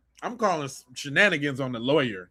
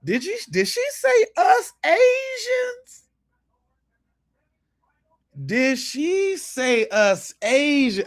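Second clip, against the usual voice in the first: shocked outburst; 9 semitones higher, slower than usual. Real, not acted. Reacting to the clip, shrieks in disbelief.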